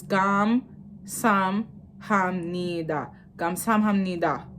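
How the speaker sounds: "Kamsahamnida" slowly, split into separate syllables; broken up. Shown as a demonstration. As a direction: pause between the parts of "Kamsahamnida". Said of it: "when you break it up".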